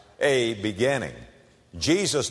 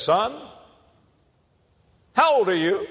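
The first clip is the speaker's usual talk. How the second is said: put-on learned old doctor; 8 semitones higher than usual. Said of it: "strokes his beard and says".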